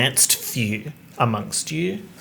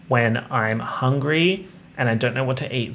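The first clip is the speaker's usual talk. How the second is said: ordinary talk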